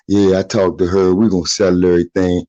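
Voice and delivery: low-pitched mock reassurance; dismissive friend. Friend insists he and the mother will settle, dismissive. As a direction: play the unbothered friend, voice dropped. Voices the friend's lame excuse.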